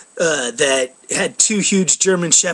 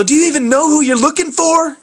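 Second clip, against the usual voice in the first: retold yell; 8 semitones higher than usual. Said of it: "I even yelled at the guy".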